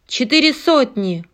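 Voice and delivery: loud call from the crowd; shouted bid. Acted. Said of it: "выкрикнул участник аукциона".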